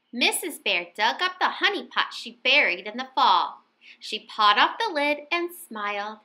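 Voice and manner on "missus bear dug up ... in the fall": ordinary delivery, nothing marked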